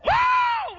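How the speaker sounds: long, very high, loud "whoo"; full-out jubilant whoop, falling at the end; shouted whoop. Retold or imitated. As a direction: let loose a soaring holler. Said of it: "We shouted".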